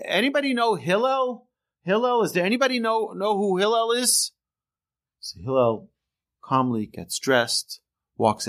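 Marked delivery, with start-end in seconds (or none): 0.1-4.3 high-pitched calling out, no real volume; mock yelling. Bettor hunts for Hillel at the bathhouse, mock yelling. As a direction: imitate someone hollering round for a person. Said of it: "he starts yelling over there".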